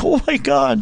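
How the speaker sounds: high, scared gasp of a shark-terrified wife; high, frightened squeal about a giant shark. Retold or imitated. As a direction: squeal it like you're picturing the shark. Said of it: "Shelley's like".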